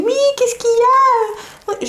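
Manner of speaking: high singsong, drawn-out mock midwife; imitated midwife. Midwife answers the patient's bell, high singsong impression.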